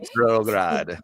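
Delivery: slow "Petrograd" in a put-on Russian accent; mock Russian accent. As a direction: savour "Petrograd" like a hammed-up Russian. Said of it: "I like saying it".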